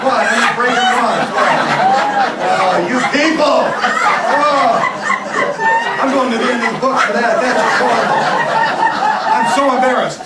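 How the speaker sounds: loud, high-pitched comic protest; mock outrage. Magician protests to the audience about the broken wand, mock outrage. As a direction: make a big indignant show of embarrassment.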